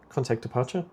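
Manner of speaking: ordinary talk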